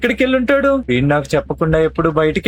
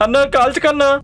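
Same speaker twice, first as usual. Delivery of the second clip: scared plea; 6 semitones higher than usual. Acted. Guard threatens with a gun.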